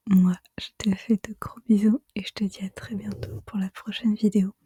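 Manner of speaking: hushed ASMR whisper. Real, not acted. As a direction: whisper it all, close to the mic. Avec ma voix chuchotée.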